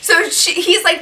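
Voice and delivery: normal talking voice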